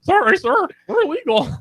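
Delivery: put-on squeaky high boy's voice, way up; young Keon impression. Friend imitating young Keon's high voice to a cohost. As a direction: pipe it high like a voice not yet broken. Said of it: "That's my impression of young Keon".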